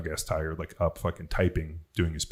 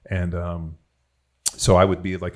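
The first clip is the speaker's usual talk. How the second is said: usual speaking voice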